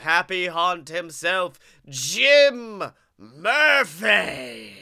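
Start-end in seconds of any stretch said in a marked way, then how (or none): 1.9-4.8 drawn-out announcer. Acted. Host introducing co-host.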